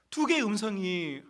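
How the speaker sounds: ordinary talk, nothing marked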